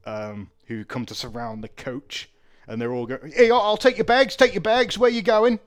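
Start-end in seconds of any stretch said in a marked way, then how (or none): 3.3-5.6 high, loud hawking for custom; clamouring shout. Acted. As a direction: shout over a crowd to grab their attention. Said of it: "all sorts of shouting, uh, screaming".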